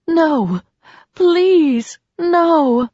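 high, falling wails; anguished pleading. A narrator's reading. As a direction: plead in heartbreak, each word stretched out. Heartbroken woman pleading in story.

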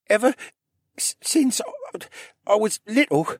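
high, stop-and-start stammer; faltering, broken-up young character voice; rough, halting voice. A narrator's reading. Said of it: "started in a rough voice that started and stopped".

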